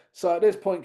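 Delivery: ordinary delivery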